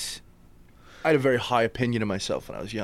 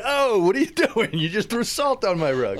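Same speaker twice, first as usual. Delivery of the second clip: alarmed customer; 9 semitones higher than usual. Retold or imitated. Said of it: "they're like".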